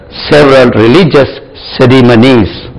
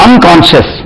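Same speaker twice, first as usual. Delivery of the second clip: normal talking voice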